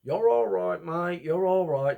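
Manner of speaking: loud, high Australian reassurance; Aussie accent, all right mate; hammed-up Australian, loud. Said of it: "are you Australian?".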